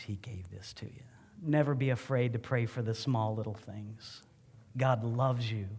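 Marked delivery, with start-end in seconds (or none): 0.0-1.0 tender whisper. Real, not acted. Tender thought to Benny.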